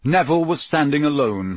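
plain reading voice

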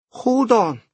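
put-on quick, sympathetic young waitress. Waitress talking to Ed.

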